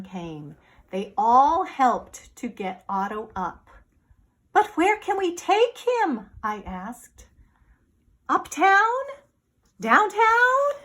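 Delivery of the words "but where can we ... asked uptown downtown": high, urgent questions; anxious boy. Acted. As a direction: ask in a hurry.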